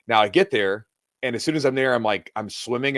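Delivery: ordinary delivery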